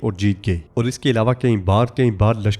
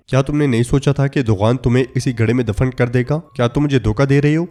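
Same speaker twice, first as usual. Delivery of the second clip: usual speaking voice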